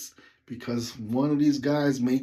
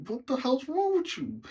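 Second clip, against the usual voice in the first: higher exasperated question; driver's baffled thought; baffled inner thought; 13 semitones higher than usual. Retold or imitated. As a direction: ask in mock disbelief. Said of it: "just thinking in my head".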